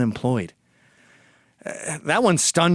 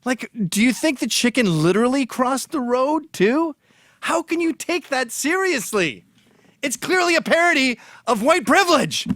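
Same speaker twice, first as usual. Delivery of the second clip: higher, whiny mock outrage; mock indignant; 8 semitones higher than usual. Imitating a humorless critic, mock outrage. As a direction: parody the prissy critic.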